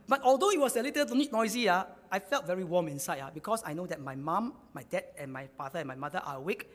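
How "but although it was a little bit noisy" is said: usual speaking voice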